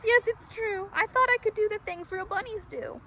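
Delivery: high, wailing confession. Acted. Tiny confesses to Marvin he is not a bunny, high wailing. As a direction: wail it out as a crying elephant. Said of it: "He wails".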